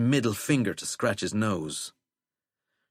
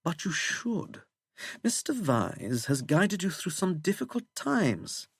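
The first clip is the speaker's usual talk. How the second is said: put-on plummy English accent; 9 semitones higher than usual. A narrator's reading. Withering reminding the president of his patron, plummy English accent. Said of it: "in that plummy English accent".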